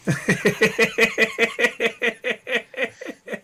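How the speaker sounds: high, loud burst of laughter; long loud laugh. Real, not acted. Player bursts out laughing at a Twitch chat comment.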